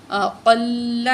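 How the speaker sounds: اقل drawn slowly with a thick qaaf; teacher stresses heavy qaaf. Shown as a demonstration. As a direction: give the qaaf in اقل full weight. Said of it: "موٹا کر کے پڑھیں گے".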